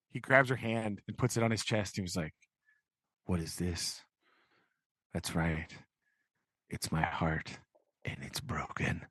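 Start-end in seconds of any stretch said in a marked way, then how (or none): 3.3-9.1 low, soft mock-tragic delivery; hushed heartbreak. Host plays Pip showing Havisham his broken heart, hushed heartbreak. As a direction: fade to a near whisper. Pip's heartbroken speech, acted.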